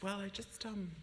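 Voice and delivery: hesitant confession. Retold or imitated. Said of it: "It's like".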